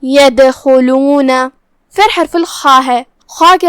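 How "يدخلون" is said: slow يدخلون with the د bounced before the خ; qalqalah on dal. Shown as a demonstration. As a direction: give the د in يدخلون a short echoing release. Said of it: "دال کو ہلاتے ہوئے یہ کلمہ کو ادا کریں گے".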